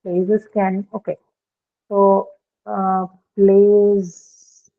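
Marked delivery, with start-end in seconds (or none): 3.4-4.7 PLAYS loud and stretched into one long syllable; drawn-out word. Shown as a demonstration. Teacher showing students a single syllable, PLAYS drawn out. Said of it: "just one syllable".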